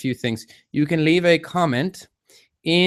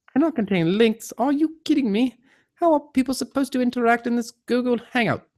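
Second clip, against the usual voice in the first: high-pitched, incredulous complaint; baffled, high-voiced exasperation; exasperated; 10 semitones higher than usual. Real, not acted. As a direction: protest in rising disbelief at the software. Chat refuses his link.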